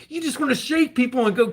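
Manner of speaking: normal talking voice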